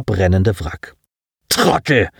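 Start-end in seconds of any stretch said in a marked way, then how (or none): 1.5-2.1 hissed insult. Acted. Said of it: "fauchte Alexander".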